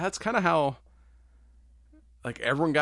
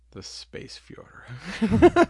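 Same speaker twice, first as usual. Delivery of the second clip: laughing whisper; 7 semitones higher, 6 dB louder, much slower than usual. Pretend. Joke punchline while laughing.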